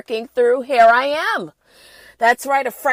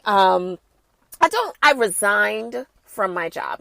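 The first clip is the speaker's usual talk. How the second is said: ordinary talk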